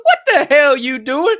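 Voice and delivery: high, loud laughing "what the hell"; surprised laughing shout. Retold or imitated. He laughs in surprise at his friend waiting in her car. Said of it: "I said".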